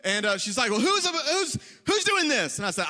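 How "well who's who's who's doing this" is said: high, exasperated mock demand; indignant mom. Mom questioning teenager about shaving, high mock outrage. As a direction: demand the culprit as an outraged mother.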